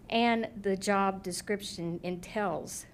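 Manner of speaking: ordinary delivery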